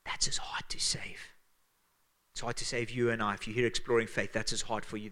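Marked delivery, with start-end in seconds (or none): 0.1-1.3 whispered refrain. Acted. Pastor whispers the refrain to the church, hushed. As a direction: drop to an intimate whisper.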